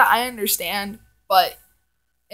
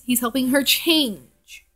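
usual speaking voice

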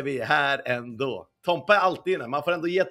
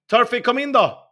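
louder, higher call; 6 semitones higher, 8 dB louder than usual. Real, not acted. Streamer calls his friend Turfy into the stream, loud. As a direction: call him in across the room.

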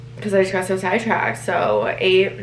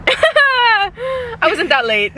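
laughing; 4 dB louder, much slower than usual. Real, not acted. Laughing at friend's tease.

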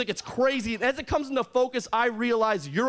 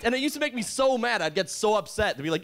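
ordinary talk

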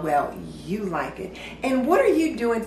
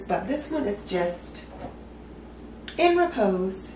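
ordinary talk, nothing marked

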